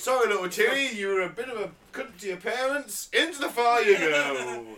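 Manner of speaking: a hammed-up executioner sending a child to the fire; hammed-up judge condemning a naughty child; mock scolding little Timmy. Mock scolding a child before hell.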